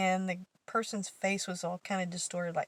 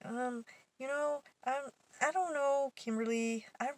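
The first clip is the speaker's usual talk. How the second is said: hesitant; 6 semitones higher than usual. Retold or imitated. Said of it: "she was like".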